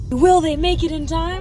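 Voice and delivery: loud teaser narrator voice; dramatic reality show hype. Pretend. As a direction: hype it like a cliffhanger. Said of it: "so we're off".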